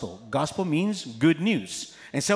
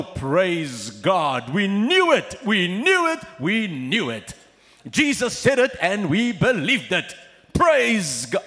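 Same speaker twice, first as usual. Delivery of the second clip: loud, higher, jubilant chant of praise; excited praise; 4 semitones higher, 6 dB louder, slower than usual. Retold or imitated. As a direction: celebrate it like a triumphant crowd. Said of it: "and the disciples go".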